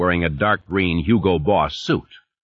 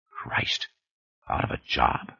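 breathy whispered inner thought, hushed; 7 semitones lower, 8 dB quieter than usual. Acted. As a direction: whisper it to yourself in dismay. Sanders's panicked inner thought.

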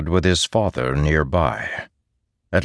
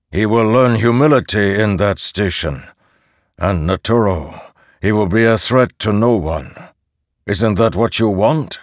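firm, louder verdict; stern judge; 4 semitones higher, 4 dB louder than usual. Acted. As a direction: deliver the sentence coldly. Posen pronounces judgment.

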